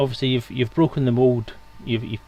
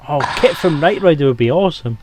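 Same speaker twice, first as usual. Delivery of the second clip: excited; 5 semitones higher, 8 dB louder than usual. Real, not acted. Excited about a Knight Rider voice.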